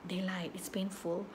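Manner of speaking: slow retold whisper. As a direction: hiss the warning slowly, as if passing on a secret. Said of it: "Dia bisik". (bisikan perlahan seperti rahsia)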